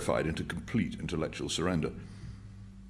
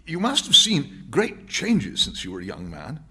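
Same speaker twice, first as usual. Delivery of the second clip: higher, slow, careful question; tentative question; 8 semitones higher, 6 dB louder than usual. A narrator's reading. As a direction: probe the old man carefully. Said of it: "said Winston, tentatively".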